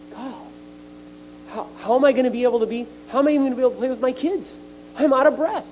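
high, fast, breathless-sounding worry; panicked thought. Retold or imitated. Telling the church his despair atop the steps, high and rushed. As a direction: rush it out, high and alarmed.

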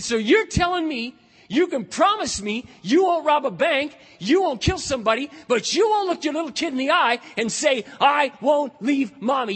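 high, loud, hammering each clause; incredulous confrontation. Retold or imitated. Preacher confronts friend who won't promise his kids, incredulous. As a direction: drive the contradiction home at full voice.

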